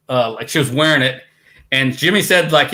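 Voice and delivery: ordinary talk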